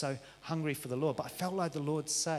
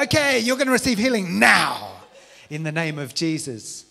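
mock Western preacher; 3 semitones higher, 12 dB louder than usual. Preacher mocking how Western churches pray for healing, in a hammed-up loud voice. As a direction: ham up a limp Western preacher.

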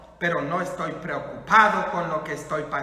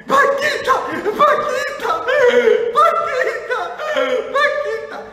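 very high, loud mock squeal; hammed-up fangirl shrieking; crazed fans; 10 dB louder than usual. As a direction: scream the name like a frenzied concert crowd. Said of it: "todo el mundo bien alocado".

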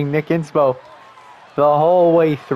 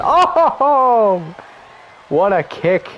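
excited; 5 semitones higher, much slower than usual. Real, not acted. Big kick reaction.

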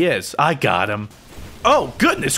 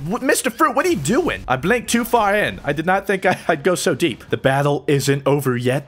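normal talking voice

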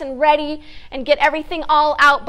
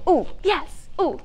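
ordinary talk, nothing marked

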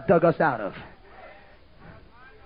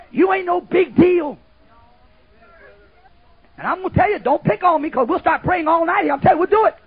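preaching warning; 8 semitones higher, 6 dB louder than usual. Acted. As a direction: lean in and warn them hard.

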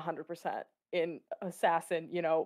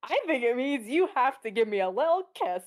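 higher, lilting playful come-on; coy, raised-voice flirting; flirty teasing; 5 semitones higher, 4 dB louder than usual. Acted. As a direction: tease them flirtatiously, pitched up. Flirty Obi-Wan teases Cad Bane.

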